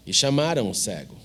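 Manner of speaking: normal talking voice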